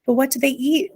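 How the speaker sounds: high, worried wail; lightly acted fretful wail. A narrator's reading. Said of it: "wailed Homily".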